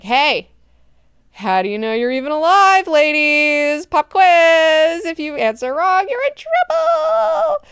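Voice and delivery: loud and high, rising to a squeal; hammed-up god-emperor taunt. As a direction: tease them with a loud pop quiz. Leto playfully quizzing the ladies.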